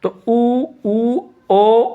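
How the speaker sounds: ऊ and ओ said high with lips rounded; rounded vowel demo. Shown as a demonstration. Teacher showing class lip rounding on ऊ and ओ. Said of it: "होठ गोल हो गया कि नहीं हो गया?".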